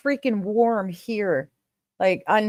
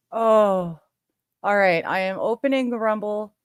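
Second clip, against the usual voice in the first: usual speaking voice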